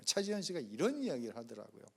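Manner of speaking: ordinary talk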